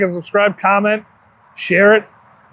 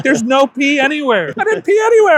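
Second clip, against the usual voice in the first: mock whiny protest; 10 semitones higher than usual. Retold argument with janitor.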